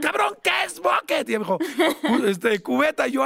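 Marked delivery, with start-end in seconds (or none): 0.0-1.3 high, slow mock shout; high slow squeal of a question; imitating Cristina. Cristina asking what bucket means. 2.1-3.1 higher, slightly shouted fumble; high stuttered answer; imitating Cristina.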